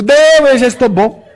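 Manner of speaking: high, loud comic protest; hurried refusal. Retold or imitated. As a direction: beg off in mock panic. Begging off painful treatment, comically.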